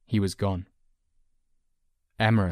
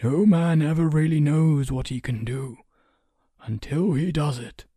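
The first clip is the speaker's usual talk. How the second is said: instructive counsellor; 7 semitones higher, 5 dB louder than usual. A narrator's reading. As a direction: point it out in a lifted sage voice. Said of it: "Emerus pointed out".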